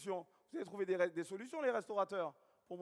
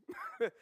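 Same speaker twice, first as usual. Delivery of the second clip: short high laugh; high burst of laughter; 5 dB louder than usual. Real, not acted. Speaker laughing at his own story to the audience, high laugh.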